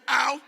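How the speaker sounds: comic "ow"; mock ow of pain. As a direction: pretend yelp at a poke. Repeats poked forehead reaction.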